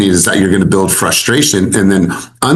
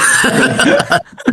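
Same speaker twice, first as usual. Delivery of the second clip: high breathy laugh. Real, not acted. Host laughs at the pronunciation.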